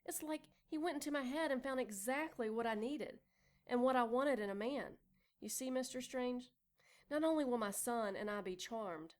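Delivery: plain reading voice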